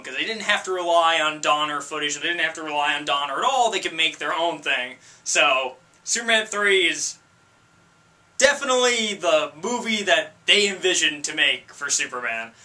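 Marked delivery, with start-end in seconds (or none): none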